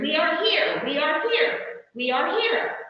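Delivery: high little chanted cheer; understated group cheer; mild cheer. A narrator's reading. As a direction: cheer it lightly, like a storybook crowd. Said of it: "and they cheered".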